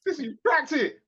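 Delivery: laughing through the words; giggling tease; laughing, playful. Real, not acted. Teasing, laughing at cohost.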